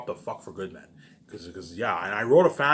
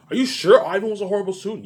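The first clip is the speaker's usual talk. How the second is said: higher, louder disbelieving question; incredulous question; 10 semitones higher, 8 dB louder than usual. Retold or imitated. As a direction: ask it like you can't believe it. Voicing a doubting listener.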